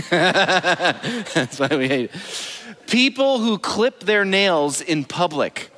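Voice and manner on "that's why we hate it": slow repeat through real laughter; laughing. Real, not acted. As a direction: chuckle the punchline out slowly. Repeating punchline while laughing.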